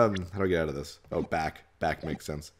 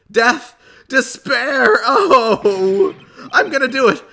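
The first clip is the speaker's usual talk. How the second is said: really whiny, high and loud wail; Larry Butts; 14 semitones higher, 11 dB louder, slower than usual. Acted. Despairing client screaming in court lobby, whiny voice. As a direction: wail hysterically in a hammed-up whine. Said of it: "like, a really whiny voice".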